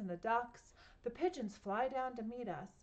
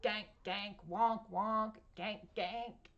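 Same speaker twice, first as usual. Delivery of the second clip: small duck honks; 4 dB louder, slower than usual. A narrator's reading. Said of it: "and they say".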